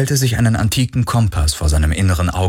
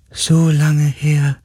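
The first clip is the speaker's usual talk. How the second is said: high, slow, drawn-out spirit voice; dying being; 8 semitones higher, much slower than usual. A narrator's reading. A dying spirit remembers Galen, slow faraway voice. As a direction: let the words float out slowly. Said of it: "Die Stimme eines sterbenden Wesens".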